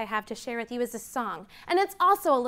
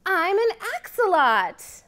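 higher, bright announcing voice; excited reveal; 7 semitones higher, 5 dB louder than usual. Acted. Teacher revealing the answer to children, excited. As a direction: proclaim the answer like a prize.

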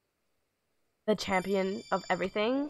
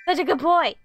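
high, loud puppy praise; gushing dog praise; 6 semitones higher, 7 dB louder than usual. Real, not acted. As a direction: gush loudly over the good dog.

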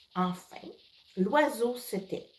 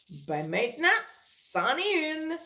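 cheeky retort; 5 semitones higher than usual. A narrator's reading. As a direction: stretch it out smugly. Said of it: "répond Lincoln".

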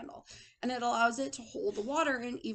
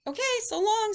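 high, loud cheery goodbye; cheerful; 10 semitones higher, 7 dB louder than usual. A narrator's reading. Rosie tumbles away from Hank, cheerful farewell. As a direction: chirp a goodbye, pitched up. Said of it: "said Rosie cheerfully".

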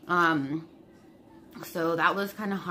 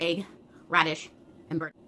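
ordinary delivery

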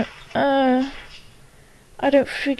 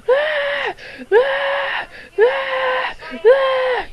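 imitated short screams; 14 semitones higher, much slower than usual. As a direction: mimic her short, high screams. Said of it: "I love how she screams".

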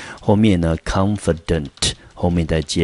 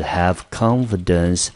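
deliberate phrase demo; slow model phrase; slower than usual. Shown as a demonstration. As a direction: have confidence said slowly and clearly. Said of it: "也是同学呢经常会用到的".

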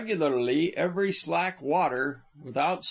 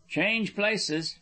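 higher-pitched brisk order; commanding; 6 semitones higher than usual. A narrator's reading. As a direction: give it as a command. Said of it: "Charlie commanded".

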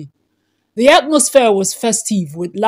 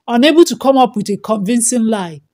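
ordinary delivery

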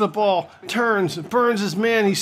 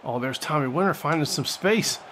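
usual speaking voice

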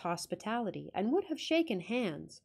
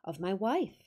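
plain reading voice

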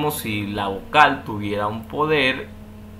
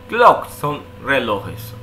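slow; much slower than usual. Shown as a demonstration. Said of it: "Por ejemplo".

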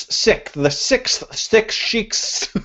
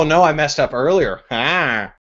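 usual speaking voice

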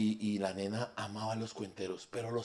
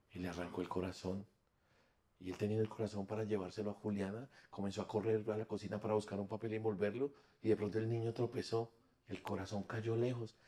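hushed story narration; 3 semitones lower, 7 dB quieter than usual. Acted. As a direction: draw the audience into a dark tale quietly. Tells the tale hushed.

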